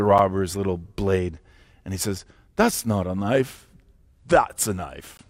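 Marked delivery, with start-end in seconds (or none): 2.6-5.2 put-on Australian accent. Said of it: "Australian accent".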